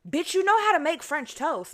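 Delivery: high, loud burst of self-discovery; excited realization. Retold or imitated. Realizing she can make French toast, excited and loud. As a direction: tell yourself the answer like a eureka.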